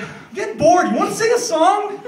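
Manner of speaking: high, loud, needy whine; bored whiny guy. Retold or imitated. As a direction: pester like a bored kid. Annoying runner voiced.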